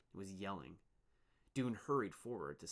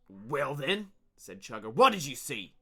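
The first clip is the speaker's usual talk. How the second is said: demanding; 3 semitones higher, 12 dB louder than usual. Acted. Leader demanding answer.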